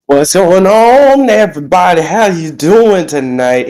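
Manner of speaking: slow, drawn-out high-pitched crowd greeting; working the crowd like a ring announcer; hype greeting. Acted. Wrestler greeting the fans before his promo, drawn-out hype.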